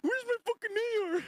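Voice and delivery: fast high whiny imitation; mock high squeal. Streamer echoing the clip guy, fast high squeal.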